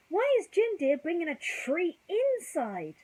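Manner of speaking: high, slow, wide-eyed pup voice; curious puppy. Acted. Puppy asks why Jim Dear brings a tree inside, high and slow, wondering. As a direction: ask it like a small puzzled puppy.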